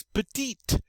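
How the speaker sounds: PETITE ending in a clear T; sounded final T. Shown as a demonstration. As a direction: say "petite" with the closing T heard. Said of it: "when we add the E, we get to".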